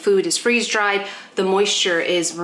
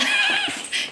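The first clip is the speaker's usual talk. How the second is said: squealing laugh. Real, not acted. Squeal at excited dog.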